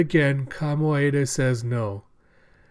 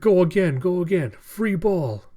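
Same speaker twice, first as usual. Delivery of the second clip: higher, louder team call mid-rally; called-out cheer; 5 semitones higher, 6 dB louder than usual. Retold or imitated. Teammates urging another attack, called out. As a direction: yell encouragement across the court. Players calling out during rally.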